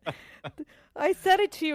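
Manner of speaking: real laughter breaking into the words; giggling, losing the sentence; trying not to laugh. Real, not acted. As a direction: apologize through a laugh you can't hold back. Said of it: "I don't mean to laugh".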